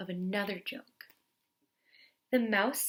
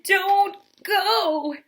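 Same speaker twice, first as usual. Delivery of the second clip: high, loud crying plea; loud, high crying wail; 11 semitones higher, 11 dB louder than usual. Acted. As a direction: throw the wail at him, begging. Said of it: "wailed the bear".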